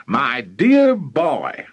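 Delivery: loud, slow, drawn-out exclamation; excited cry. Acted. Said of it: "cried Mister Killiecrankie".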